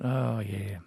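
ordinary talk

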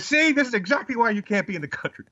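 high-pitched mock indignation; retold exasperated telling-off; exasperated outburst. Retelling an exasperated outburst at kid.

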